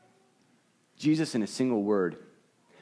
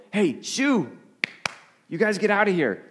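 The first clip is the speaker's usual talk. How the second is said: higher, raised voice shooing animals off; 7 semitones higher than usual. Retold or imitated. To the raccoons eating the food: shooing call. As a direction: shoo the raccoons away from the tent. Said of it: "and I go".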